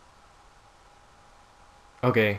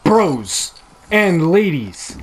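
slow, louder mock-grand greeting; grand address; 5 semitones higher, 6 dB louder, slower than usual. Real, not acted. Gamer addressing viewers after a win, slow and grand. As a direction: savour the win.

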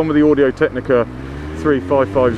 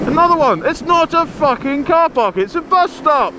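furious roadside outburst; real road-rage yelling; angry shout. Real, not acted. Yelling at a car in bus stop.

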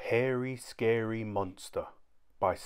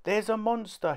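sobbing; 11 semitones higher than usual. A narrator's reading. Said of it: "he sobbed".

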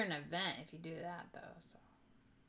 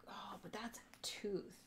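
soft unvoiced whisper to self; 9 dB quieter than usual. Real, not acted. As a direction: mutter it under breath. Whispered aside.

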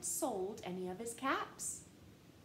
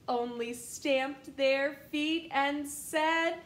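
usual speaking voice

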